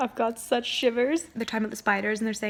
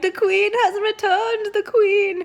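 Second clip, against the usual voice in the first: mock proclamation; 9 semitones higher, 6 dB louder, slower than usual. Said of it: "be like".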